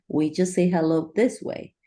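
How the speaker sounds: ordinary talk, nothing marked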